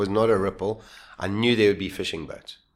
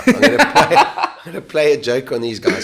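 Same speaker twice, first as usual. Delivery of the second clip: giggling through the words; laughing; 4 semitones higher, 9 dB louder than usual. Real, not acted. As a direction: chuckle while speaking. Laughing while telling prank.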